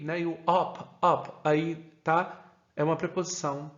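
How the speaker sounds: ordinary talk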